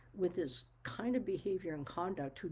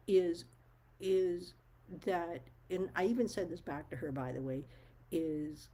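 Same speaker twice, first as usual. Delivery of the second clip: usual speaking voice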